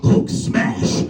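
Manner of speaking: rough, voiceless roar of a toy monster; big raspy brute impression; Hulk. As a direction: growl it out like an action figure. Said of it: "put it into the back of the Hulk".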